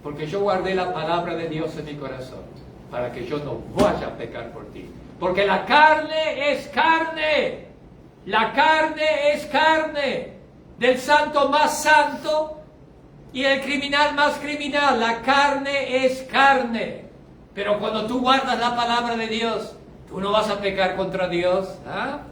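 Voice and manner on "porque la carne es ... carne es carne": drawn-out words, higher pitched; preacher slowing right down to hammer it; very slow emphatic. Acted. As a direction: stretch each word out for weight.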